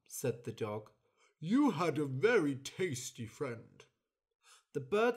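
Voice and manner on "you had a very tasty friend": smug dog. Acted.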